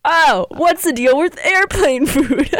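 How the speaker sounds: high, loud, drawn-out comedian's voice; Jerry Seinfeld. Acted. Player mimicking a comedian's airplane food bit, exaggerated impression. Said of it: "It's Jerry".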